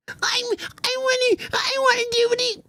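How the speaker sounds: tiny, very high mocking squeal; mock desperate whining. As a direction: whimper it in a hammed-up squeaky voice. Mocks desperate people.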